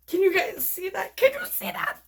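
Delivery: bursting with delight; thrilled. Real, not acted. Showing the cells to viewers.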